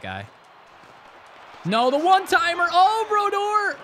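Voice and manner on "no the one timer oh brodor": high, loud wail at the game; dismayed outcry. Real, not acted. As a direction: cry out in high disbelief. Reacting to a save.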